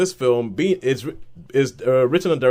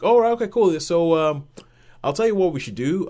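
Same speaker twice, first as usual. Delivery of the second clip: higher, offhand presidential brush-off; breezy dismissal; 5 semitones higher than usual. Acted. President responding to news of the comet, breezy dismissal. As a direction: brush it off lightly, pitched up. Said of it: "the president is kind of like".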